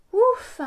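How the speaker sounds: high, loud sigh of relief; relieved ouf. A narrator's reading. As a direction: blow out relief after hard work. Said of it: "Quel travail".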